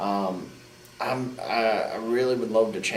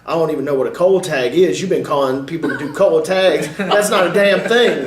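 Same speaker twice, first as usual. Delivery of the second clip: loud, much higher, laughing mock tirade; laughing mock rant; 6 semitones higher, 12 dB louder than usual. Telling off the fan who calls every spot, loud laughing rant. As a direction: blow up at him, half laughing.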